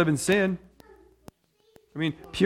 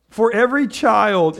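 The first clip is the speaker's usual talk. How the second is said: slow, raised reading voice; scripture reading; 6 semitones higher, 7 dB louder, slower than usual. Acted. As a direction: read the verse out with weight.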